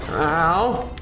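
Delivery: ordinary delivery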